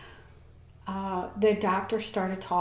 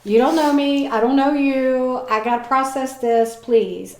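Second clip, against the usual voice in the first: louder, defensive pushback; retelling how she snapped at the lady, louder; retold snapping; 4 semitones higher, 7 dB louder than usual. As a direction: snap back at the kind stranger touching her.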